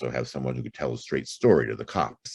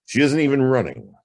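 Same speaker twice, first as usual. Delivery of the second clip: higher and louder, pressing the point; emphatic reveal; 10 semitones higher, 6 dB louder than usual. Acted. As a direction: hit it hard, voice jumping up. Detective reveals twist.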